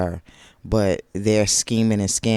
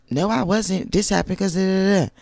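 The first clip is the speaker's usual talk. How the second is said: higher, indignant pretend Tisha; mock Tisha voice; 9 semitones higher than usual. Vlogger voicing Tisha's missing explanation, higher and indignant. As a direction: play Tisha explaining herself, pitched up.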